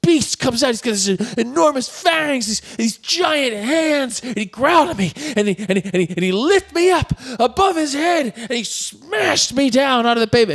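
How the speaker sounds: high, breathless-with-drama biker tale; hammed-up victim retelling an attack; overdramatic witness account. Acts biker's exaggerated account to cop.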